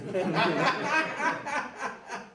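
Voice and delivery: a real burst of laughter after the joke; hearty laugh. Real, not acted. Preacher laughing at his joke about being fat. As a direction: laugh out loud, falling away.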